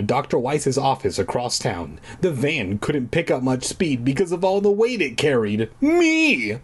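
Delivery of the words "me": high indignant exclamation; exclaimed. Acted. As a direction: blurt it out. Self-deprecating punchline.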